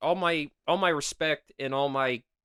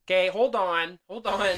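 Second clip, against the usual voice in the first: higher, louder hold on; urgent protest; 6 semitones higher, 6 dB louder than usual. Real, not acted. Host cuts in to defend himself, urgent and raised. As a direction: stop her right there.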